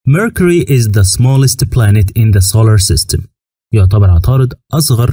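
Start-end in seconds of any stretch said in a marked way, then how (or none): 0.1-3.3 slow, careful English for learners; slow teaching read. Shown as a demonstration. Teacher reading the Mercury fact slowly to students. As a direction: read it clearly, word by word. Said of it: "teacher models English sentence".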